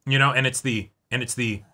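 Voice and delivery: ordinary talk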